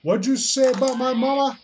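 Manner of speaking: loud, high, fast challenge; mock outrage. Reacting to an insult about mama, mock outraged. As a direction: play at taking offense at an insult.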